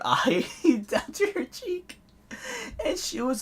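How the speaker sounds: breaking into high laughter; laughing while talking. Real, not acted. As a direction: squeeze the words out through laughter. Laughs while telling the story.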